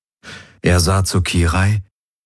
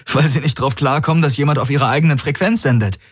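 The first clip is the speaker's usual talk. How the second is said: fast, higher, bubbling with relief; relieved excitement; 7 semitones higher, faster than usual. Acted. Garland answers Hox with laughing relief, fast and excited. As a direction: tumble it out half-laughing.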